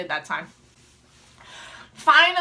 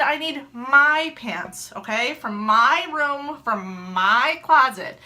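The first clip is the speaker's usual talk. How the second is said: slow emphatic; much slower than usual. Retold or imitated. Instructing panicked husband.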